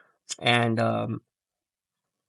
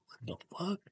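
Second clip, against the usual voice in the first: bewildered; 3 semitones higher than usual. Retold or imitated. Waking up confused, retold.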